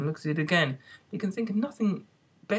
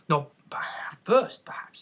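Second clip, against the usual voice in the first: unvoiced hushed whisper; whispered aside; slower than usual. A narrator's reading. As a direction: whisper it like a secret. Hatter whispering in story.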